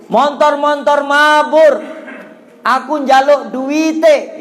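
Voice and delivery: high, loud, drawn-out chanting yell; villagers shouting. Retold or imitated. Villagers yelling up at a passing plane, long sing-song shout. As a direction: call up to the plane, stretching the words. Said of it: "orang kampung teriak-teriak".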